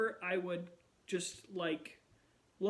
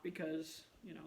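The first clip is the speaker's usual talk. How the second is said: normal talking voice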